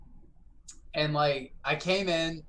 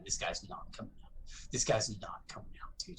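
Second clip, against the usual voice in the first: whispered, much softer; hushed; 5 semitones lower, 8 dB quieter, faster than usual. Real, not acted. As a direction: stay quiet as you wait. Tense waiting, hushed.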